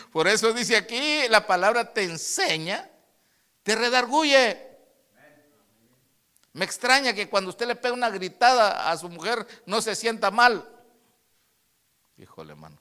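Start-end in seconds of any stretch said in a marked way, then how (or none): 0.1-10.7 loud, raised preaching voice; prédica enérgica. Real, not acted. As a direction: drive the point home to the congregation, high and loud. (prédica a plena voz, aguda y fuerte)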